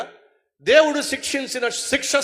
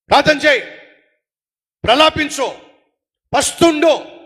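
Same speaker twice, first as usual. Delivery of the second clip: each command loud and higher, a pause after each; firm commands; 3 semitones higher, 4 dB louder than usual. Real, not acted. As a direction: hammer out each instruction. Preacher commanding the congregation.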